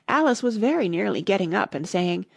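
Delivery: ordinary delivery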